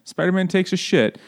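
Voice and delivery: high-pitched silly title announcement; mock issue announcement. Podcaster mocking endless comic issues, high mock announcement.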